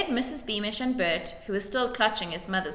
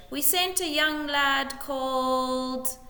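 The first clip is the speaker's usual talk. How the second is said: slow, higher, unsure; faltering lie; 6 semitones higher, slower than usual. A narrator's reading. Major fumbling a made-up story before the widow. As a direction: stall as the lie runs out.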